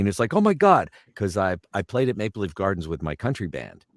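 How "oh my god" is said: high, loud astonished exclamation; Bobby Orr. Retold or imitated. Hockey star spotting an old acquaintance, loud and surprised. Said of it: "and Bobby saw me".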